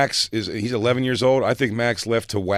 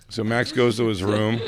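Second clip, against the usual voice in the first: normal talking voice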